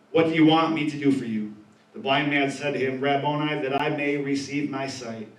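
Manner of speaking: measured reading of the passage; calm Bible reading voice; scripture reading. Acted. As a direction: read the verses out steadily. Reads scripture aloud.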